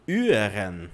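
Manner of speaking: "hören" with the initial h dropped, drawn out slowly; mispronounced German. Shown as a demonstration. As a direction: say "hören" wrongly, starting straight on an ü-like vowel. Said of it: "você pronuncia, por exemplo".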